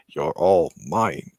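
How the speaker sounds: low, slow villain voice; Jim. Acted. Villain lurking in the background, low and slow. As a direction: drop the pitch and draw it out possessively. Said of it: "Jim is, like, in the background".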